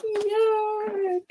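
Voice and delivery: high, squeaky yuck; grossed out but playful; disgusted. Real, not acted. Reacts to a sardine sandwich.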